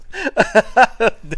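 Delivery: sudden loud burst of laughter, high-pitched; real laugh. Real, not acted. Streamer bursting out laughing at chat. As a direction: crack up at the chat. Reacting to funny chat message.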